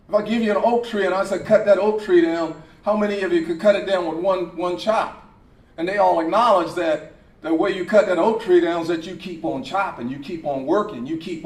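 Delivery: fast loud sermon drive; loud preaching. Acted. Preacher to players, loud driving sermon. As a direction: push it to the congregation. Preaching about oak tree.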